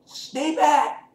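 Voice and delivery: high put-on kid warning; Carl voiced high. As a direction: warn them off in a high kid voice.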